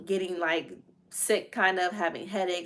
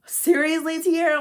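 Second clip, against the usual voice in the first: high, louder, incredulous mom; mom in disbelief; 10 semitones higher, 6 dB louder than usual. Retold or imitated. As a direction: call out like a stunned mother. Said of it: "my mom was like".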